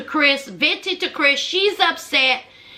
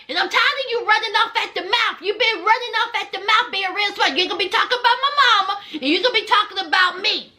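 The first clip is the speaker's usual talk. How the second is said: fast, higher-pitched imitated rant; fed-up telling-off; 4 semitones higher, much faster than usual. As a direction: rattle off the complaint as the fed-up sister. Sister telling Gabby off, acted.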